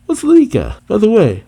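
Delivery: high, puzzled question; Luffy. Acted. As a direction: pitch it way up, like a clueless hero. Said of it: "and Luffy says".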